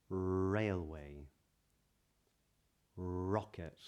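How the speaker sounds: each word spoken slowly with a curled British R; modern British accent. Shown as a demonstration. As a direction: model each R word as a clear drill. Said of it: "you hear it in words like these".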